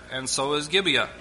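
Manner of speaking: normal talking voice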